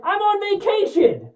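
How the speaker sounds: high mock-exasperated cry; exasperated shout. McClane complaining about his vacation, high exasperated mock shout. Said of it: "exasperatedly shouts".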